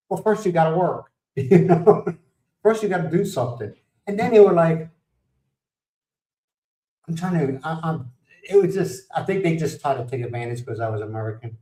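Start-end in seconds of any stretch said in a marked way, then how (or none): none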